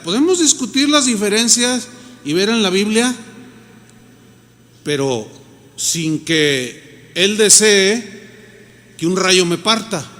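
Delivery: ordinary talk, nothing marked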